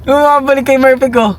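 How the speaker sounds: excited teasing. Real, not acted.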